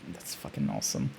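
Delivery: low awed whisper; barely voiced in admiration. Real, not acted. Admiring the character's design, low whisper.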